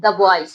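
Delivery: put-on "The" as "duh" before boys; short uh in "The", then boys. Shown as a demonstration. Teacher repeats the right reading, "The" as duh. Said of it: "क्या होता है?".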